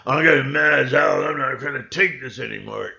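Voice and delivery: loud mock outburst, fed up; mad as hell. Telling off the pestering hybrids, loud mock outburst. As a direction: belt it out like a fed-up man on TV.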